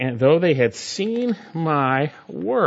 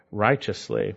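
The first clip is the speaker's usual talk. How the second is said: normal talking voice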